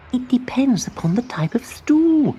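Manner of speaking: high, hushed musing; thoughtful spider whisper. A narrator's reading. Abigail ponders the riddle in a high whisper. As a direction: whisper as you think it through.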